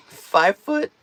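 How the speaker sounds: real voice crack, pitch jumps up on "foot"; "foot" breaks sharply upward mid-word. Real, not acted. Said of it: "a never-ending voice that cracks".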